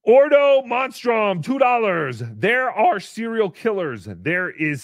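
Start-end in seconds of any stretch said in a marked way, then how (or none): 0.0-1.4 drawn-out loud announcement; slow announcer-style shout; slow shouted name. Acted. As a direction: call the name out slowly. Reading donor name loud.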